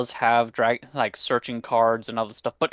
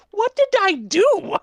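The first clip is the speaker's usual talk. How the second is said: high squeaky disbelief; baffled squeak; 5 dB louder than usual. Real, not acted. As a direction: squeak it out, stunned by what you built. Baffled at own deck.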